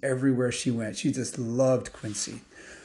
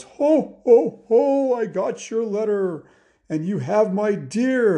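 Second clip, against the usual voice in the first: booming ho-ho-ho, pitched up and loud; jolly old Santa; 9 semitones higher, 6 dB louder, slower than usual. Acted. Santa greets Zach at the door, jolly and booming. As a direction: boom it out like a merry Santa. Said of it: "jolly old Santa".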